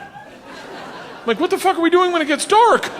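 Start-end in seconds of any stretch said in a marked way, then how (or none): 1.4-2.9 high, loud panicked question; alarmed. Retold or imitated. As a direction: blurt it out in alarm.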